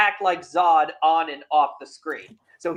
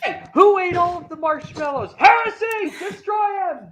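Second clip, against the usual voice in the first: high mock shout; 13 semitones higher, 3 dB louder than usual.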